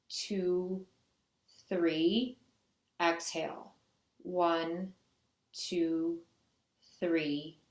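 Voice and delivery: slow, evenly spaced counting with pauses; steady yoga-teacher count, numbers drawn apart; guided breath count. Real, not acted. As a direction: count the class through breathing, one measured word at a time.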